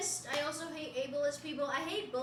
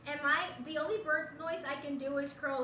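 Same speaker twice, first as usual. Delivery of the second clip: usual speaking voice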